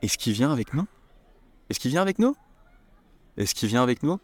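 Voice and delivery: "Est-ce qu'il" said as "ess-ki", the L dropped; casual spoken French. Shown as a demonstration. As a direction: say it like a native, quick and run together. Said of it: "We say".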